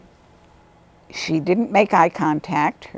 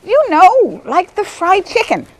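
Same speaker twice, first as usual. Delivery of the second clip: high, loud mock-eager student voice; voicing an excited pupil, much higher; imitating the student; 13 semitones higher, 6 dB louder than usual. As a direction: burst out like a student proud to find the word. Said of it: "And then finally she said".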